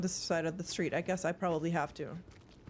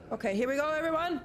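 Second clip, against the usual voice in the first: higher, climbing rally cry; excited announcement; 8 semitones higher, faster than usual. Real, not acted. Streamer rallying everyone as things begin, excited rising call. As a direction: build upward to hype up the group. Rallying chat as game moves on.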